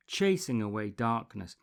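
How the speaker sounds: usual speaking voice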